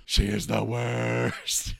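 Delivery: slow, dragged-out contempt; slow exasperated grumble; drawn-out disgust. Acted. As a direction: stretch every word in disgust. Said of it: "And he's like".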